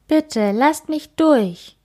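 put-on mild whispered child plea. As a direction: beg to get through, barely daring. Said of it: "flüstert Kim".

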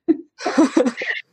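short high giggle; giggle, breaking out of the quote; breaking character giggling. Real, not acted. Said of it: "ich muss lachen".

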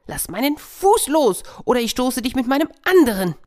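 very high, loud and fast hare's threat; a squeaky, panicked hare shouting. Acted. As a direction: yell it in a frantic rush.

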